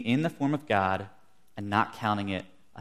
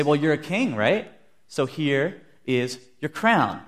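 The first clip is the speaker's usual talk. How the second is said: loud, mock-ceremonious taunt; acted soldiers' mockery, raised in pitch; making fun; 4 semitones higher, 6 dB louder, slower than usual. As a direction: present it with fake deference, slowing at the end. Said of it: "they make fun of him".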